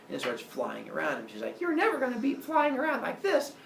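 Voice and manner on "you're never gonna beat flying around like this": tiny, high-pitched boastful voice; taunting gnat. Acted. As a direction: tease like a little flying insect. Goddess as gnat taunting Zeus.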